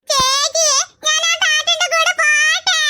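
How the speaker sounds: put-on high, sweet toddler pleading to go; little child voice. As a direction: sound like a small kid asking his sister.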